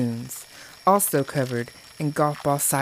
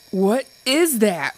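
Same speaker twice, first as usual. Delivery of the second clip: yelping; 7 semitones higher, 4 dB louder, slower than usual. A narrator's reading. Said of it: "Brody yelped".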